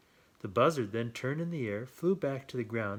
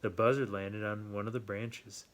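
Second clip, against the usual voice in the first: plain reading voice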